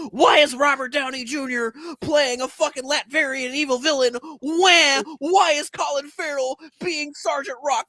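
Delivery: high, hammed-up crybaby wail; mock a tantrum with loud drawn-out wahs; whining fan. Podcaster mocking entitled fans who complain online all day, whining.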